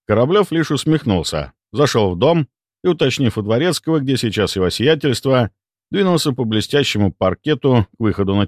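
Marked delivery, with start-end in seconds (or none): none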